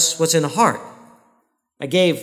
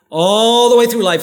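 fervent preaching; 4 semitones higher, 7 dB louder than usual. Real, not acted. Preacher rising in fervour.

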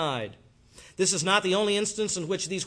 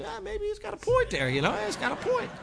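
musing to oneself. Retold or imitated. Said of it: "we go home and think".

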